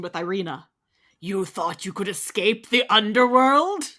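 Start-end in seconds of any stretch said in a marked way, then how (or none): 1.2-3.8 slow menacing villain. Acted. Villain Irina threatens.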